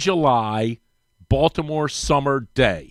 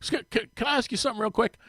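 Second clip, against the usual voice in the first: sheepish stammer; 8 semitones higher, 6 dB quieter, much faster than usual. Retold or imitated. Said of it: "And he's kinda sheepish".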